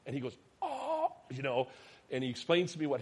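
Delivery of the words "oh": put-on very high-pitched child's "oh"; imitated high-pitched reaction; high mock "oh". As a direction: squeal an oh like a caught kid. Son reacts to the button.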